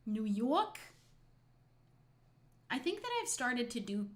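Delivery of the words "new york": put-on "New Yawk" accent; New York accent. Streamer playing at being a New Yorker, exaggerated New York accent. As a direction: lean into a broad New York vowel. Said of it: "how much I love to do this accent".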